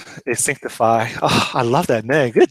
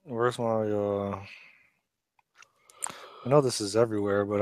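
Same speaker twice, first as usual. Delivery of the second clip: whispering; 2 semitones lower, 8 dB quieter than usual. Real, not acted. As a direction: whisper while searching. Searching code, talking to himself.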